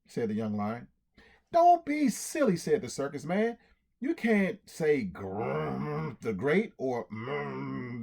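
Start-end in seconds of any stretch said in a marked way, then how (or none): 1.5-2.6 mock scolding. Circus man scolds lion. 4.0-8.0 mock mumbling. Circus man mocks lion's mumbling.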